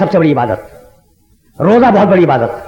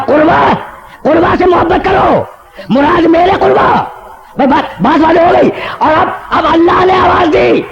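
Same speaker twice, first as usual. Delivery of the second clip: full-force preaching; 11 semitones higher than usual. Acted. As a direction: thunder it out like a divine announcement. Preacher quoting God's proclamation.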